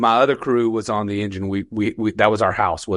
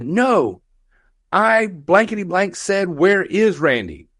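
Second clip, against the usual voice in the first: mock irate correction, pitched up; exasperated captain; 9 semitones higher, slower than usual. Captain jerking firefighter back by air pack, mock exasperated demand. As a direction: imitate a captain's cross, emphatic demand.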